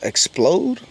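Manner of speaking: disbelieving rising "Explode?"; incredulous echo. Real, not acted. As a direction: repeat it back in disbelief.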